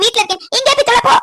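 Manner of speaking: usual speaking voice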